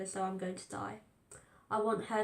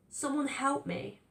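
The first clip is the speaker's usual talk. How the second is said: slow, stretched cry for help; desperate plea; 5 semitones higher, slower than usual. A narrator's reading. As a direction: beg for rescue, frozen in fear. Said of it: "I scream to myself".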